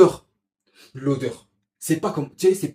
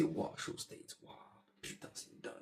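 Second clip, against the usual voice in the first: chuchoté émerveillé; 22 dB quieter than usual. Retold or imitated.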